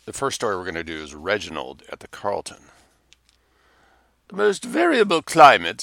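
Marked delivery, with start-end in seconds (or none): 4.3-5.8 put-on high, loud, thin old duchess voice; frail withering duchess. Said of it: "said the Duchess".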